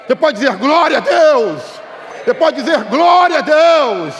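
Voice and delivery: loud, high preacher building to drawn-out cries; full-force preaching. Real, not acted. Preacher urging the church to cry for mercy, full-force preaching. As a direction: rouse the congregation into shouting praise.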